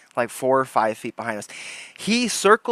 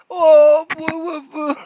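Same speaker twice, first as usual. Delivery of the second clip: much higher, loud mocking boos; mock booing; 10 dB louder, slower than usual. Booing the troll as he drives away, mock high boos. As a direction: boo him like a taunting kid. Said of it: "I just started doing that".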